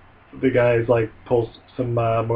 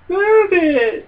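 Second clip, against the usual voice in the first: loud, very high mock-child cry; taunted little kid; 5 dB louder than usual. Littlest kid to taunting siblings, high yelped protest. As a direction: yelp it like a bullied child.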